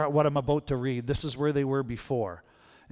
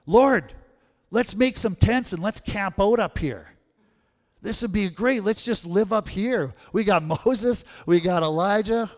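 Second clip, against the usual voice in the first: eager, raised and louder, gushing; excited Peter; 4 semitones higher, 4 dB louder than usual. Acted. Preacher voicing Peter, eager and loud. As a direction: Peter begging to stay on the mountain.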